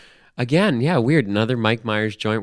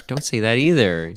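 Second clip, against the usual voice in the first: ordinary delivery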